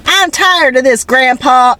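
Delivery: put-on high, whiny little girl voice. Quoting the girl's flashback line to her grandpa, high and whiny. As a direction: complain like a tired kid. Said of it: "she goes".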